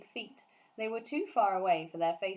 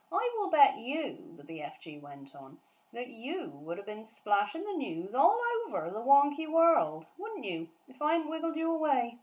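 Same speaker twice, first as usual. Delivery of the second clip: higher, louder, animated giant; BFG's voice; 6 semitones higher, 5 dB louder than usual. Acted. Giant warning Sophie about giant hunts, animated giant voice. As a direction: rattle on like the excited friendly giant.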